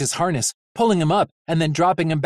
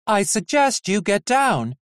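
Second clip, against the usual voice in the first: lightly voiced robot nurse; 7 semitones higher than usual. Robot nurse calm warning.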